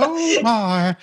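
over-the-top high Southern drawl; exaggerated Southern accent. Co-host imitating the film's Louisville character, hammed-up Southern accent. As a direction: ham up a movie Southerner. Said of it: "it is very close to that, how he talks".

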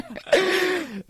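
high-pitched, loud laugh. Real, not acted. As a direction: burst out laughing at your own aside. Laughs after own dry aside.

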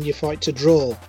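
ordinary talk, nothing marked

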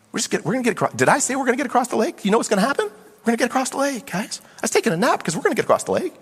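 high, light, disbelieving questions; playful incredulity. Acted. Jesus to disciples after his nap, high and incredulous. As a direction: reason with them in a high, amused voice.

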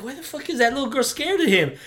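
high-pitched imitated bewilderment; baffled viewer. Viewer wondering about the frightened girl, baffled. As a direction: ask it in mock confusion. Retells viewer's puzzled reaction.

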